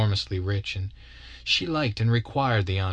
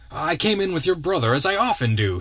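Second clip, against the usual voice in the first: cold; 8 semitones higher than usual. Acted. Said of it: "he answered coldly".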